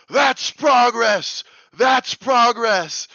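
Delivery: high, slow, drawn-out shout; yelling. Retold or imitated. Swimmer in the water to kids on the boat, acted yelling. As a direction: yell it up at them, stretching each word. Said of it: "I'm yelling at them".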